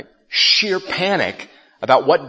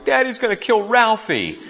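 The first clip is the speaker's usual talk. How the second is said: lightly mock whining little boy, higher; a hint of a scared kid whimpering; 9 semitones higher than usual. Little brother hiding under the sink, half-hearted whine. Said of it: "he's whining".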